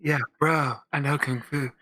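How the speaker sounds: a hint of a laid-back movie star; Keanu. Answering the co-host's question about the voice, light impression. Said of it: "Is that your Keanu voice?".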